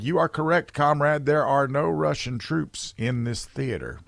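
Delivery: smug, slow sarcastic payback; mock sarcastic reply. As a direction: throw the denial back, deadpan. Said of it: "made another phone call and said".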